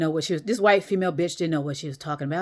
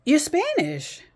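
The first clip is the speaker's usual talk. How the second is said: higher, set-apart voice, pressing the point; retold insistence, much higher and pointed; retold blunt insistence; 10 semitones higher, slower than usual. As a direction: tell her plainly, leaning on Spanish. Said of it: "I said, I said".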